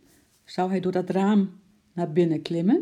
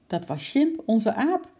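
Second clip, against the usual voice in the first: slightly strained, higher admission; a touch of nerves, pitched up; nervous; 6 semitones higher than usual. A narrator's reading. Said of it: "zei moeder zenuwachtig". (een beetje zenuwachtig)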